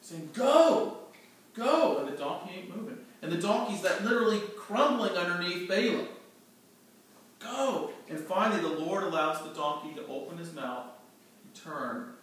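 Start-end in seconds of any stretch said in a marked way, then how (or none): 0.3-2.0 loud, much higher yell of Go; angry shout. Acted. Balaam beating his stubborn donkey, shouting higher and louder. 7.4-7.9 higher, sharp call of Go; urging yell. Acted. Balaam yelling at the crumbling donkey, higher.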